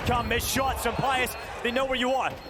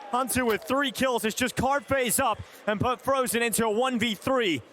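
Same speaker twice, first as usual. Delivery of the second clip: ordinary talk, nothing marked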